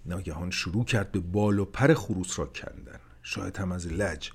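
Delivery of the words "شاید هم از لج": ordinary delivery, nothing marked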